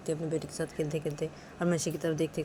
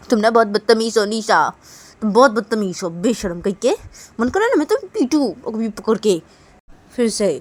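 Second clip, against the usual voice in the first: loud, raised-pitch telling-off; angry scolding; 4 semitones higher, 11 dB louder than usual. Acted. Manisha calling Nisha rude and threatening her, angrily. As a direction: lay into them with acted anger. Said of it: "फिर से गुस्सा कर रही हो".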